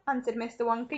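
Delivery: usual speaking voice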